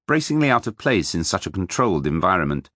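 usual speaking voice

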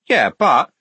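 high, loud, cut-short objection; flustered protest. A narrator's reading. Soldier answering an angry sergeant, flustered.

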